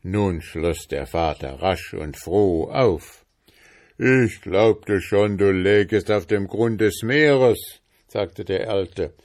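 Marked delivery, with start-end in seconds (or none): 4.0-7.8 put-on old father. A narrator's reading.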